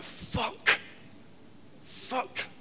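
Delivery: hushed, voiceless swearing; whispered curse. Retold or imitated. Drug user hiding from friend at the door, whispering. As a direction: swear under your breath so nobody outside hears. Acting dread as friend shouts outside.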